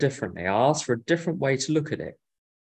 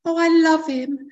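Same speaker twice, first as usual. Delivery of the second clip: very high, loud, sing-song mock swooning; gushing lovestruck romantic; 6 dB louder than usual. Mocking someone who thinks romance will fix everything, gushing lovestruck voice. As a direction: gush in a silly falsetto, besotted with love. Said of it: "Thinking that".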